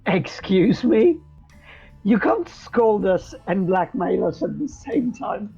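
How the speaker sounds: ordinary talk, nothing marked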